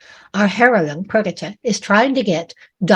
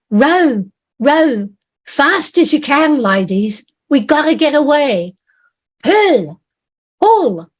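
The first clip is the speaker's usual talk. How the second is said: loud, higher urgent shout to row; sailor shouting; 6 semitones higher, 3 dB louder than usual. Acted. Sailor urging the women at the oars, shouting. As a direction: bark orders at the rowers over the noise. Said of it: "A sailor shouts".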